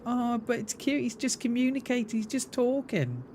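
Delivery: high, cooing pet-owner voice; mock pet voice. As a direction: gush softly over a cute dog, mockingly. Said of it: "you like my pet voice".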